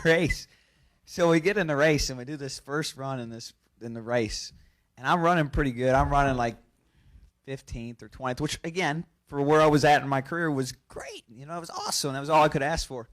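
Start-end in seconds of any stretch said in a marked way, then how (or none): none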